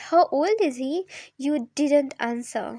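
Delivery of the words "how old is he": ordinary delivery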